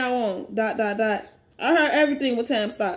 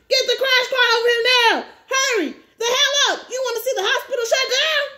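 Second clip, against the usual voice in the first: urgent orders; 9 semitones higher than usual. Acted. Staff rushing to save the dying patient, high urgent calls. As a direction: bark the orders in a panic. Nurses rushing emergency in hospital.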